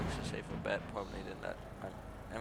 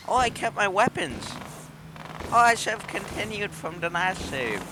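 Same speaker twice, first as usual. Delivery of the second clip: high, loud sudden realization; surprised; 10 semitones higher, 7 dB louder than usual. Real, not acted. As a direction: blurt it out in surprise. Surprised discovery in game.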